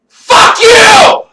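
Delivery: high, loud imitated yell at the crowd; mock shout. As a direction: bellow it like a comic to sixty thousand. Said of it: "for you to just say".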